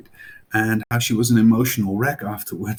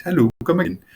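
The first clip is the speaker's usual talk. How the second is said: light mock Indian accent, pitched higher and louder; 9 semitones higher, 6 dB louder, faster than usual. Brits mocking Indian speakers, in a light mock Indian accent. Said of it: "They make fun of the Indians for the accent".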